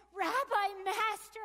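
loud, high cry of recognition; Mary recognising the risen Jesus, crying out loud and high; joyful cry. Acted. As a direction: cry out in joy and awe. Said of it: "I cried as I fell".

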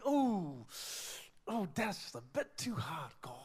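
mock-pained complaining to God; daunted complaint. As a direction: wince through a reluctant complaint. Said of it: "or are we".

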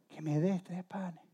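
slow, soft plea; hushed repeated request. Acted. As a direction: ask softly and slowly in a sleeping house. Repeats request to sleeping household.

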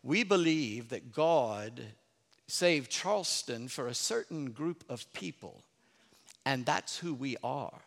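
slow, low Charleston drawl; Charleston accent. Retold or imitated. Preacher imitating a Charleston lady on the holy city, slow Charleston accent. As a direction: draw it out softly in an old Charleston accent. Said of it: "this is a Charleston accent too".

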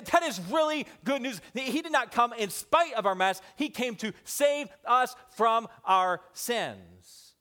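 high, loud, fervent sermon delivery; full-force preaching. Acted. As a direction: preach it with rising fire.

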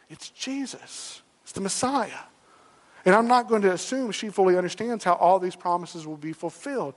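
ordinary talk

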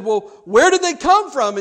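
high, very loud exasperated question; baffled demand. Retold or imitated. Young preacher pressing Jim about the strangers, exasperated. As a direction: push hard for an answer. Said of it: "fuming, and now he's confused".

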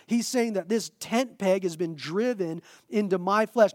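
ordinary delivery

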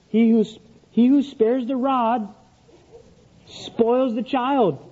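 high, slow, drawn-out scripture quote; mock proclamation. Father reads Proverbs to justify spanking, mock solemn. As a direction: proclaim the verse like a verdict.

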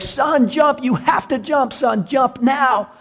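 high, insistent urging; acted frantic calling out; desperate father. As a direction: command a trapped son with rising desperation. Father pleading as fire worsens.

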